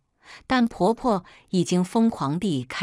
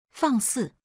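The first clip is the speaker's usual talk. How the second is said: higher-pitched indignant rebuke; 6 semitones higher than usual. A narrator's reading. Elder scolding an insolent granddaughter, indignant. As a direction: rebuke with mild outrage, voice raised in pitch.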